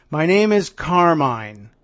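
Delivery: slow, lightly put-on New York tough guy; a hint of a New York mobster introducing himself; thick New York accent. Reading a caller's threat aloud, lightly voiced New York accent. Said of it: "a man with a thick New York accent".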